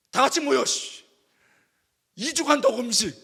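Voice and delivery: loud, exasperated command; frustrated order. Retold or imitated. Pastor frustrated at student, exasperated loud order.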